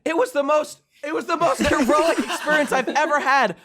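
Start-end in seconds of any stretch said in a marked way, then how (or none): none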